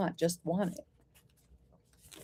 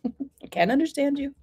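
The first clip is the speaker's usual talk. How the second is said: imitating mother; 7 semitones higher than usual. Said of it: "My mother used to say".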